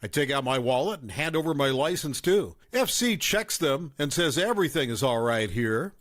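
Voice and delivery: plain reading voice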